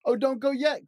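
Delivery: lightly voiced elephant, high plea; pleading cry. A narrator's reading. Elephant begging the doctor to stay, high pleading cry. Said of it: "cried Elephant".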